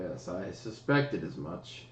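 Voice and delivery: low, slow, lightly voiced old man; a hint of a grave elder; quiet knowing reply. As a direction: say it like he already knew. NPC calmly confirms suspicion.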